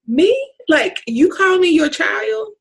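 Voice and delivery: high, slow, astonished questions; amazed disbelief. Real, not acted. Amazed at God's love.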